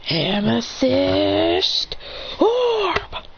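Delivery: drawn-out build to a high final word; attack call. Acted. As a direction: announce the special move very slowly. Character calls out a jutsu name.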